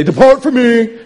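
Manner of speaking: high, loud mock banishing shout; booming, hammed-up dismissal; mock stern rejection. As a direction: thunder it out like a condemning judge.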